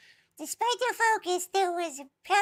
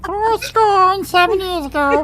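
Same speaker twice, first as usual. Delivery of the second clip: Elmo voice Lincoln speech; 14 dB louder than usual. Pretend.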